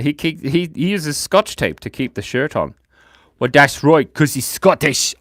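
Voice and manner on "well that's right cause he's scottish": put-on half-remembered Scottish accent; mock Scottish, not quite landed; attempted Scottish accent. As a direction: have a shaky go at a Scottish accent. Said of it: "I can't read the Scottish accent".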